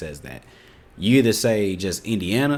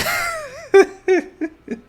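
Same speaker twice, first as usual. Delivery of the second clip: high squealing laugh; high burst of laughter; 4 dB louder than usual. Real, not acted. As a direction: break into a shrill laugh. Laughing at fake slang.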